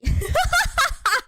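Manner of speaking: high, loud laughing fit; big squealing laugh; helpless laughter. Real, not acted. Laughs at own bills being ugly.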